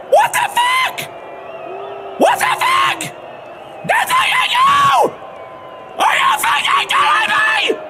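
shrieking high in frustration; high frustrated shrieks. Real, not acted. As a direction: scream it high, fully losing it. Frustrated gamer screaming.

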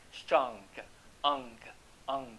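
"strong" and "ong" slowly, with a released g; pretend final g. Shown as a demonstration. Teacher drilling the hidden ending, "ong" with its g released. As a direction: add a faint "guh" after each "ong". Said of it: "你要假装去念个".

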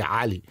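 "Ali" with a guttural Arabic opening. Shown as a demonstration. Explaining his real name, guttural Arabic "Ali". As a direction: squeeze the A of "Ali" in the throat. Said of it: "An is a guttural A".

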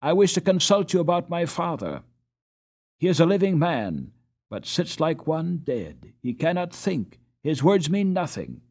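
high, loud, urgent appeal; earnest pleading. A narrator's reading. Boy pleads for his father.

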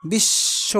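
বিশ্ব said as bisso, with the s doubled; doubled sound demo. Shown as a demonstration. Teacher to students, modelling বিশ্ব as bisso. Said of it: "মানে দুইবার উচ্চারিত হয়েছে".

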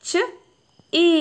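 "ച" short and "ഈ" alone, long gaps between; sounding out. Shown as a demonstration. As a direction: sound out "ച" and "ഈ" one by one. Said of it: "അപ്പോൾ ഇവിടെ".

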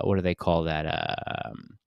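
ordinary delivery

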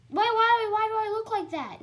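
high, loud startled why; shocked. Real, not acted. Gamer shocked at his own avatar, loud and high. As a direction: gasp out the why in alarm.

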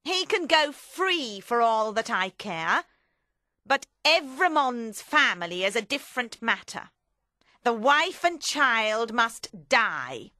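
hard, deliberate character voice; Madame Defarge. Acted. Madame Defarge demands family's death.

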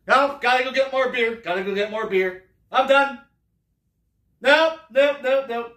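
high, loud fed-up nopes, storming off for beer; exasperated yelling. Acted. Fan fleeing the halftime show, high loud exasperated yelling. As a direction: bolt from the room shouting nope.

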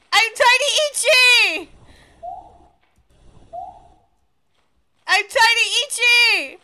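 high gleeful repeated yells; excited shouting. Real, not acted. Chanting new name gleefully.

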